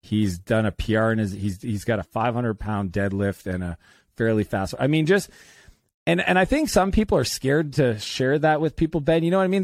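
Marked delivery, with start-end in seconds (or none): none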